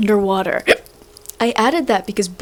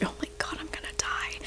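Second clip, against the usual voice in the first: breathless whisper, very soft; hushed melodramatic despair; whispered exasperation; 7 semitones higher, 16 dB quieter than usual. Real, not acted. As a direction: whisper it like you're dying of frustration. Exasperated aside.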